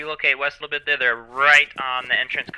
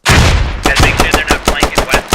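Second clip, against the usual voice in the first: loud callout; 3 semitones higher, 10 dB louder, slower than usual. Real, not acted. Callout to squad in firefight.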